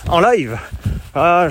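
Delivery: ordinary delivery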